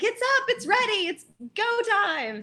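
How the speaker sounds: high-pitched imitation of an excited launch call; excited announcement. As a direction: announce it high, stretching out the last words. Quotes designer announcing site launch.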